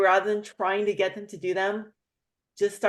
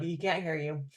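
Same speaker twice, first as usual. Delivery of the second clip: softer, sly under-the-breath; hushed aside; 3 semitones lower, 7 dB quieter than usual. Retold or imitated. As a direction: whisper it to the boyfriend. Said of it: "I'm like".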